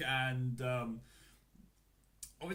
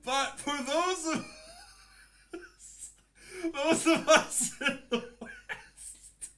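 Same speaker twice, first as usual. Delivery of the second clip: high, louder, slow words through laughter; laughing; 13 semitones higher, 7 dB louder, slower than usual. Real, not acted. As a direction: barely get the words out laughing. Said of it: "how do I say this with a straight face".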